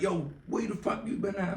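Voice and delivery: high accusing voice; high mock demand; mock accusing friends. Friends confront him.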